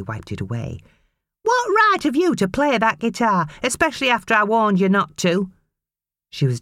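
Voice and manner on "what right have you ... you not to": high, sneering mother's voice; scornful mother. A narrator's reading. Mother dismissing her son, scornful and high. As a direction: brush the boy off with scorn.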